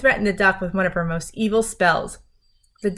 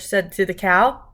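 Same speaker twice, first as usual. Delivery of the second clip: usual speaking voice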